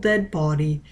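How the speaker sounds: ordinary delivery, nothing marked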